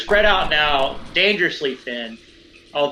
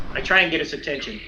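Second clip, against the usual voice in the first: ordinary talk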